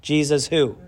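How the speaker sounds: ordinary talk, nothing marked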